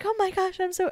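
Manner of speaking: high-pitched mock dismay; retold shock. Retells shock at being cold.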